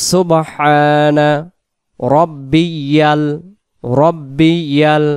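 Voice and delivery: drawn-out Arabic recitation, each syllable stretched; slow recitation demo. Shown as a demonstration. Imam teaching the rukū glorification, slow clear recitation. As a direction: chant the words slowly and clearly for learners. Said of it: "তিনবার, পাঁচবার এবং সাতবার পড়া যাবে".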